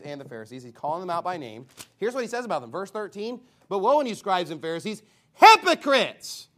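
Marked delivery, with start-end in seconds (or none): 3.7-6.5 preacher's booming woe; exclaiming woe. Acted. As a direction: thunder the rebuke from the pulpit. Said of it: "mine has an exclamation mark at the end".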